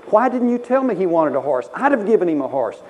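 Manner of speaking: loud, high indignant scolding; scolding grandmother. Retold or imitated. Grandmother scolds mother at boy's funeral, loud imitated outrage. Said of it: "she jumps all over my mother".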